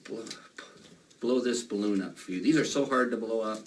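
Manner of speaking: usual speaking voice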